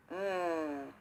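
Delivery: low, contented mmm; savouring hum. A narrator's reading. Country Chipmunk tasting the jams, low pleased hum. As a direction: taste it and love it.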